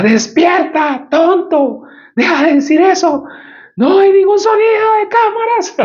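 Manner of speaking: a wife shaking her husband awake with anger; hurt and furious. Retold or imitated. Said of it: "entre dolida y furiosa".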